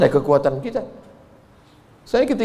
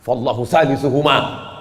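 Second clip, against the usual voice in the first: recited verse; 4 semitones lower, slower than usual. Acted. Quranic quote recited slowly.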